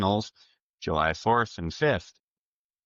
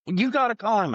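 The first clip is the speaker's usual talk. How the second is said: much higher, urgent imitated excitement; excited Dion; 14 semitones higher, 3 dB louder than usual. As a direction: pitch it up like a buddy bursting with news. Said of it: "Dion was absolutely plumb excited".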